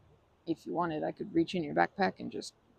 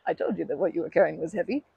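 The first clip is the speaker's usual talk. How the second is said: laughing through the words; can't stop laughing as she speaks; 2 semitones higher, 8 dB louder than usual. Real, not acted. After her friend throws the rock, laughing.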